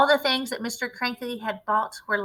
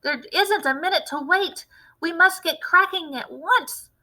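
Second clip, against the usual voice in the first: higher, pressing urgency; eager shout; 7 semitones higher, 5 dB louder than usual. A narrator's reading. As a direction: hurry him along loudly. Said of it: "shouted Mister Cranky".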